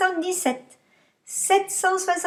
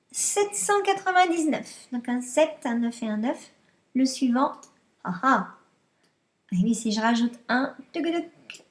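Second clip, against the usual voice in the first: ordinary delivery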